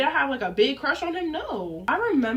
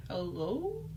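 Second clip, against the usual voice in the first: wary phone greeting; 3 semitones lower, 8 dB quieter than usual. Retold or imitated. Re-enacts answering unknown call.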